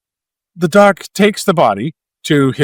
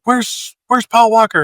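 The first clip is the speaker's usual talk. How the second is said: mock frantic asking; 8 semitones higher than usual. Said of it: "and they're like".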